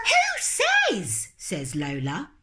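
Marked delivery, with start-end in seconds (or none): 0.0-1.3 put-on high, sharp indignant child; indignant gasp. Lola outraged at the bath, high. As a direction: gasp then demand.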